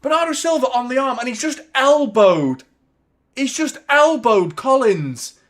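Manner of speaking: high, loud indignant commentary. Real, not acted. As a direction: protest the elbow. Angry at the foul.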